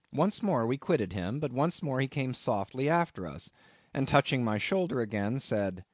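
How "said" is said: ordinary delivery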